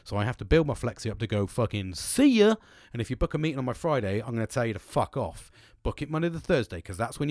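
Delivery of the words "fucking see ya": high, loud, drawn-out goodbye; mock parting shout, slow and raised; gleeful sign-off. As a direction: stretch it out as a cheeky farewell. Acts out leaving work early.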